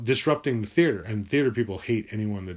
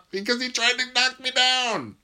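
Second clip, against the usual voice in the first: very high, squealed through laughter; gleeful gloating; 12 semitones higher than usual. Real, not acted. Gloating over the captain's broken arm, gleeful squeal.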